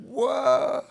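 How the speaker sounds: high, loud startled whoa. Retold or imitated. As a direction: yell it out in shock. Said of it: "I'm like".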